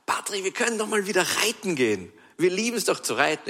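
höher, leicht verstellte Stimme der Kollegin; Berner nachgemacht. Retold or imitated. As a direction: die Freundin schwärmend leicht nachahmen. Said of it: "kommt die Berner und sagt". (die Kollegin schlägt Patrick schwärmend einen Ausritt vor, leicht verstellt)

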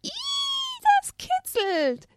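high, slow ticklish giggle; high childlike tickled laugh; giggling. Acted. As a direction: squeal through a giggle. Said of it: "kicherte die kleine Esther".

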